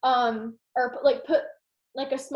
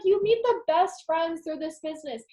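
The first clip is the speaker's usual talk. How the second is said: ordinary talk, nothing marked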